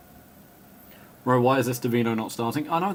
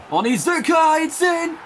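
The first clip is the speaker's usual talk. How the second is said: excited goal call; 13 semitones higher, 5 dB louder than usual. Real, not acted. Commentator calls a goal for Onizuka, excited shout. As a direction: shout it out high and loud.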